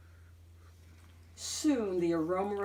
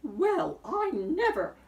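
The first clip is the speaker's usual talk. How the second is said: indignant rising huff; a scandalised old sow; old sow huffing at the rude wolf, higher; offended huff; 9 semitones higher, slower than usual. A narrator's reading. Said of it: "said the sow".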